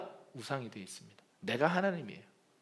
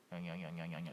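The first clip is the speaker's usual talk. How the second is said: low, much softer mumble; mumbling Pharaoh; 9 semitones lower, 16 dB quieter than usual. Retold or imitated. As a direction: murmur it under the breath. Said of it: "파라오는 읍조려요". (파라오가 낮고 작게 웅얼거리는 소리)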